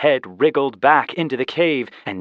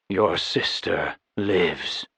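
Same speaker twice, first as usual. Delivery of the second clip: somber knight; 5 semitones lower, slower than usual. Acted.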